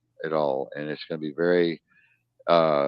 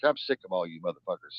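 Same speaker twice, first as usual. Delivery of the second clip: ordinary delivery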